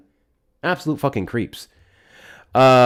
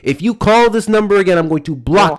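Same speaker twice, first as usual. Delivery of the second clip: loud, raised, furious warning; angry yelling; 5 semitones higher, 6 dB louder than usual. Real, not acted. Host yelling a warning at a creepy caller, furious. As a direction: yell the threat high and hard at him.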